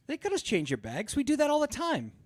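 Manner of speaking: high-pitched airline clerk imitation; breezy clerk. Baggage clerk brushing off a traveller, mock breezy. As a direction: jump up high on the second sentence, imitating her.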